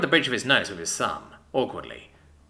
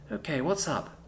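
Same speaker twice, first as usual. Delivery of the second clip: woman's voice; 6 semitones higher, 6 dB quieter than usual. Acted. Female character voice.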